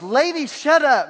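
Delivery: mock exasperated shout; retold outburst of frustration; frustrated. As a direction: snap it high and loud, imitating a fed-up preacher. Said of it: "he's getting frustrated with her".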